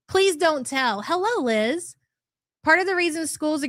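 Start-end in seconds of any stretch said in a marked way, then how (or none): none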